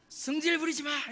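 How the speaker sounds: mock angry outburst, pitched high; 화내는 목사님 흉내. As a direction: imitate a pastor suddenly scolding his students. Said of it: "이러시면 막 화를 내세요". (버럭 화내는 흉내)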